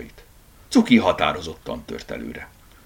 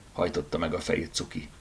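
ordinary delivery

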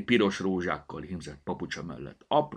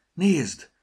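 excited; 11 semitones higher, 8 dB louder than usual. A narrator's reading. Mother points out cherries.

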